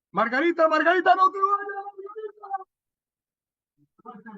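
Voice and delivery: high, loud call from a distance; calling from far off. Shown as a demonstration. Teacher demos a voice calling from the third sound plane. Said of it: "El tercer plano a primer plano".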